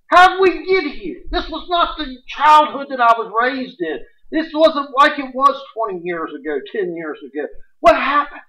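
high, loud preaching lament; impassioned lament. Acted. Preacher lamenting to the congregation, high and loud. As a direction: cry out in disbelief at the nation.

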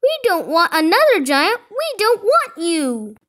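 high, insistent crowd protest; yelling. A narrator's reading. Villagers refuse another giant, yelling. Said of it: "they yelled".

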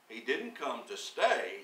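normal talking voice

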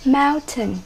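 "Mountain" stressed on the first syllable; teacher modelling MOUN-tain. Shown as a demonstration. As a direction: hit MOUN in "Mountain", ending lighter. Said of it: "Nhấn âm tiết số một".

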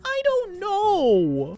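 high, drawn-out wail; slow, high-pitched exasperation; exasperated protest. Real, not acted. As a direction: stretch it out in helpless protest. Panicking over first guess.

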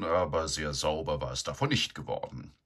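plain reading voice

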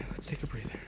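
soft breathy whisper, calming. Pretend. Minecraft streamer whispering to viewers to relax. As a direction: whisper it like a meditation guide.

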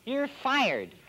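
mimicking the partner's voice lightly; borrowed partner's voice. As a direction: say it in your partner's voice. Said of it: "I'm going to use your voice".

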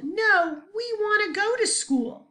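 high, loud mock protest; student yelling. Shown as a demonstration. Teacher acting a student yelling no. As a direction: yell it like a kid who wants school. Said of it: "The student yelled".

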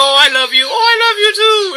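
sappy lovebird voice, rising high on the second line; syrupy mock sweetheart; mock lovey couple. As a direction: gush it like a smitten partner, hammed-up. Mocking a lovey-dovey couple.

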